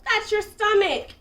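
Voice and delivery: high, loud scolding yell; loud, exasperated shout. A narrator's reading. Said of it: "yelled the squirrel".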